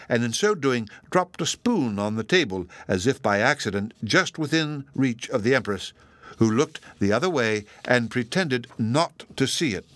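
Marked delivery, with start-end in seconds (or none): none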